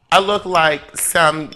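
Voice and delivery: normal talking voice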